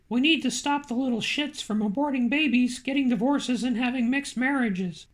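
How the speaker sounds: ordinary delivery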